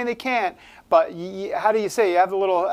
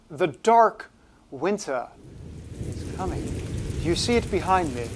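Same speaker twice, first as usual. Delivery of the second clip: measured, with long pauses, a hint of spooky narrator; Alfred Hitchcock; 3 semitones lower than usual. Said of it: "I think this is my Alfred Hitchcock".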